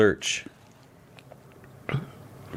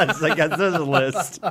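laughing; 5 semitones higher than usual. Real, not acted. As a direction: chuckle through it. Laughing at wife's list.

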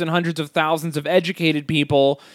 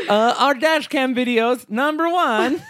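high mock Borat accent, laughing through it; Borat voice Russian bit; 8 semitones higher than usual. Still doing Russia for her friend, giggling Borat voice. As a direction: boast like a hammy Borat-style Russian. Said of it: "give me Russian".

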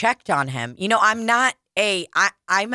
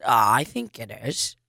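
low, smug mock reply; low, self-satisfied send-up; imitating Donald; 10 semitones lower, 3 dB quieter than usual. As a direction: drop the voice way low, unbothered. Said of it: "And he goes".

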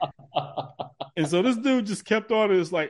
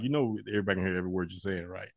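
low, fast aside; 10 semitones lower, 10 dB quieter, faster than usual. Retold or imitated. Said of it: "I'm like".

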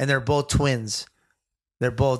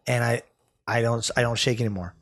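ordinary talk

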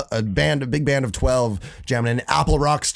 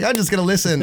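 retold protest; 7 semitones higher, faster than usual. Retells refusing to play again.

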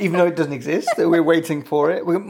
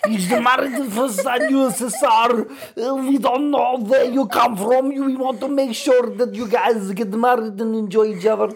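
put-on high, stilted Schwarzenegger-like accent; mock Eastern European accent; 7 semitones higher than usual. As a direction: announce a show in a hammed-up Soviet accent. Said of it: "You sound like Arnold Schwarzenegger".

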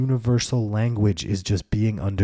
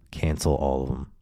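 usual speaking voice

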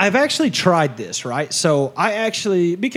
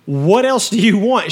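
indignant rant; 5 semitones higher, 4 dB louder, slower than usual. Retold or imitated. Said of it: "And I'm like".

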